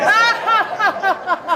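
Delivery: high burst of delighted laughter; joyous laugh. Retold or imitated. Pastor laughing in the water after finding the ring, joyous laugh. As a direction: laugh out the relief.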